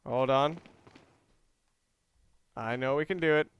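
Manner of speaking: ordinary talk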